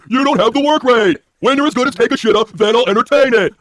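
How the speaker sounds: slow pompous mock-god proclamation. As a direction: lord it over them, drawn out. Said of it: "That's what I says".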